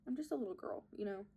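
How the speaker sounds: whispered aside. Pretend. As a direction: breathe it as a whisper.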